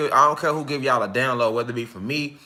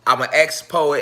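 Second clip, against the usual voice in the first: ordinary talk, nothing marked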